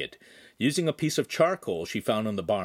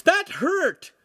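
very high, loud protest; pained scream; 6 dB louder than usual. A narrator's reading. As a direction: yell it high, hurt and indignant. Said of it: "Lincoln screamed".

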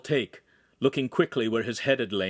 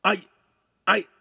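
halting, separated false starts; stammering; 9 semitones higher, 7 dB louder than usual. A narrator's reading. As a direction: repeat it helplessly with pauses. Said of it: "Ugolino repeated".

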